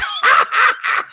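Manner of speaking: sudden high burst of laughter. Real, not acted. Laughing at himself scooting across the carpet, high laugh. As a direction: crack up mid story. Laughing at his own story.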